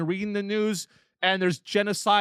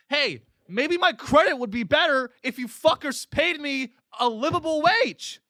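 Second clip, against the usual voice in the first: angry rant; 9 semitones higher than usual. Real, not acted. Worker yelling at employers about wages, high and angry. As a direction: yell it at the fat cats.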